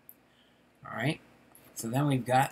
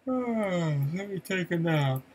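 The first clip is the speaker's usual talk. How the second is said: cartoon duck voice; 5 semitones higher than usual. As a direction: put on a high cartoon duck voice. Voicing a cartoon duck in comic.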